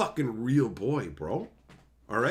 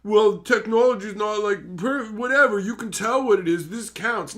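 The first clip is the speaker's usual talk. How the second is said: higher, insistent mock whine; imitating someone defending shoddy work; mock defensive excuse; 6 semitones higher, 5 dB louder than usual. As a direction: make flimsy excuses in a raised voice. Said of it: "their defense is just like".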